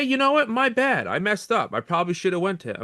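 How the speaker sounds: pitched high, a hammed-up sheepish manager; mock apology. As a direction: mimic the manager owning his mistake.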